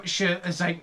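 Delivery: ordinary delivery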